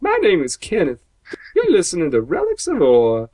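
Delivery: high-pitched, badly faked character impression; terrible high character voice; Kenneth from Thirty Rock. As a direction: pitch it up like a chirpy page. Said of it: "If it sounds like Kenneth from Thirty Rock".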